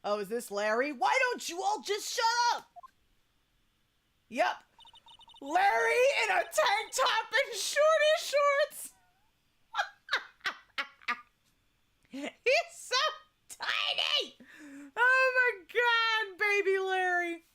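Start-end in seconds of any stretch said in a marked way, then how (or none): 0.9-2.7 high bratty yell at the class; young Larry yelling at the classmates; kid shouting. Acted. 5.4-17.4 squealing at the tiny version of Larry. Real, not acted.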